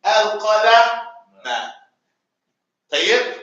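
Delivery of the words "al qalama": al-qalama drawn out syllable by syllable; slow model word. Shown as a demonstration. Teacher slowly answers his own prompt to the class. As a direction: spell out al-qalama with your voice, slowly. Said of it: "al-what, Salim?".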